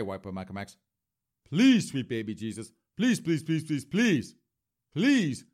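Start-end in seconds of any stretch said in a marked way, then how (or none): 1.4-5.4 mock prayer. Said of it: "I am just gonna have to pray".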